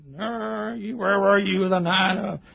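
mock finger-in-the-face grilling; a hint of a hectoring lawyer; accusing questioner. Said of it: "a guy got up, put his finger in my face".

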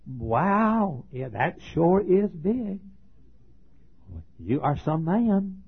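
lightly voiced southern Delilah admiring Samson's arm; swooning southern Delilah. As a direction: coo it in a slow southern drawl, impressed. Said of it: "she had a southern accent".

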